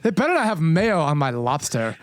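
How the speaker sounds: low-pitched bougie send-up; mock bougie. Friend parodying the lobster gripe, mock bougie. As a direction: play the fussy regular at the Cape. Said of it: "You sound so bougie right now".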